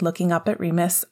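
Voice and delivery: ordinary delivery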